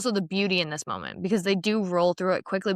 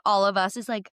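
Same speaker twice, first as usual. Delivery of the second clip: normal talking voice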